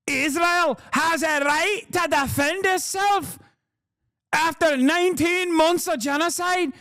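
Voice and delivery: high, slow put-on accent voice; exaggerated mock Middle Eastern accent, high and slow. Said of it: "Here, I'll give you another version of this".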